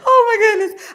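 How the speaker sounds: loud, high laugh into "Oh, my goodness"; real laughing fit, high and loud. Real, not acted. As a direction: crack up and gasp it out. Laughing at own joke.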